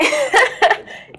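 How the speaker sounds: short high laugh, loud and bright; high burst of laughter. Real, not acted. Laughing about being underestimated, a short high burst of laughter.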